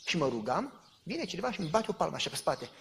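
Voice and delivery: ordinary talk, nothing marked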